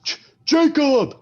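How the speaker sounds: high, loud, slow yell of Jacob; calling out. Acted. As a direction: shout after a friend who ran off. Friend calling after runaway Jacob.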